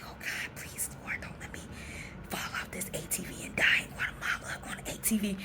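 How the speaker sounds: hushed, whispered panicky prayer; freaking out inwardly. Retold or imitated. Nervous rider praying not to fall, hushed freak-out. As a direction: plead under your breath, barely voiced. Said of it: "inside freaking out".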